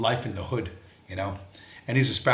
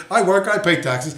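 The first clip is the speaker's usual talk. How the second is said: higher, stammering mimicry, fading out; higher flustered mock protest; mocking the kid; 6 semitones higher, 5 dB louder than usual. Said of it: "he's like".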